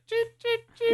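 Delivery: very high squeaky cheeps; mouse squeak. Retold or imitated. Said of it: "Real high-pitched".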